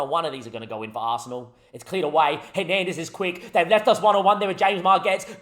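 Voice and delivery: ordinary talk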